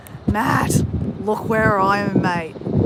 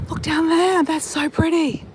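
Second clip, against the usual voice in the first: higher, thrilled at the view; delighted; 7 semitones higher than usual. Real, not acted. Vlogger shows viewers the view below, delighted and higher. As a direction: point it out with real delight.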